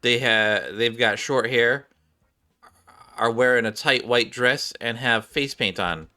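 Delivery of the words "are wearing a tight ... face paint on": ordinary talk, nothing marked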